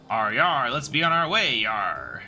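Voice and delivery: hammed-up pirate arr and yar; lightly voiced stage pirate; pirate talk. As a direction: growl it out like a mock pirate. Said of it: "Why are you talking like that?".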